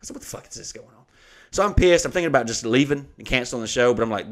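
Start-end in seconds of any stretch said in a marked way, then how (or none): 0.1-1.0 very quiet, voice nearly gone; retold baffled aside. Comedian baffled by the manager's promise, hushed retold aside. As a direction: mutter it in hushed disbelief.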